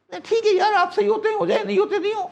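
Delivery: mock IMF voice. Mocking the IMF's reply to the host, high and amused. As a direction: play the shrugging IMF official, half laughing.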